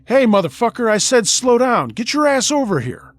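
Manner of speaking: loud, high, aggressive shouting; furious yelling. Retold or imitated. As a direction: yell at him to get over here. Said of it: "They kept calling out".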